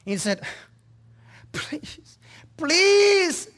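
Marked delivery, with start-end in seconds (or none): 1.5-2.2 desperate whisper. Acted. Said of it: "he cried out". 2.6-3.5 high, loud, desperate plea; a tormented man crying out high and loud; anguished cry. Acted. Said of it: "he cried out".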